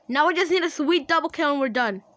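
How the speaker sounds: excited. Real, not acted. Still hyped after win.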